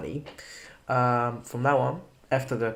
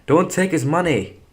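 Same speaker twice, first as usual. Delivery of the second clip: raised voice, firm warning; loud; 7 semitones higher, 10 dB louder than usual. Acted. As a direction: say it louder, insisting. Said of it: "once again, but louder".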